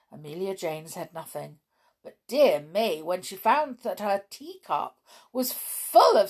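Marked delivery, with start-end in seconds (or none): none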